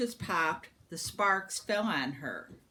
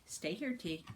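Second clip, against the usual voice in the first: quiet aside; 8 dB quieter than usual. Real, not acted.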